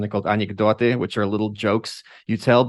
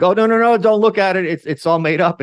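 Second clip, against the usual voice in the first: high, loud mock alarm, waving people off; hammed-up frantic denial; mock media panic, shooing viewers away; 7 semitones higher, 5 dB louder than usual.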